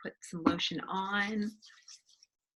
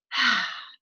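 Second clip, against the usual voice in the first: big relaxed voiced ah; loud contented sigh; 2 semitones higher, 7 dB louder than usual. Shown as a demonstration. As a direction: sink into satisfaction. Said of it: "that sense of sigh of contentment".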